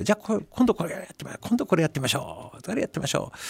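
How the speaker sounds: imitating a director hurrying the crew, quick and high; テキパキした監督の真似; brisk director. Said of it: "テキパキ進めると".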